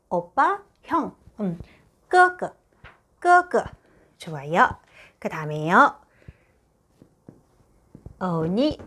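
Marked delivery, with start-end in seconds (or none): 2.1-3.6 끄 끄 said much higher, both level; Mandarin gēge. Shown as a demonstration. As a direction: say 끄끄 as gēge, high and flat. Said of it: "끄는 누구예요". (끄끄를 높은 1성으로)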